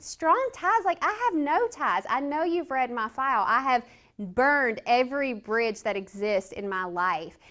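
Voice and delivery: high, loud incredulous retort; indignant disbelief. Retold or imitated. Inmate retorting to her counselor, loud disbelief. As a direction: scoff back at the counselor's excuse.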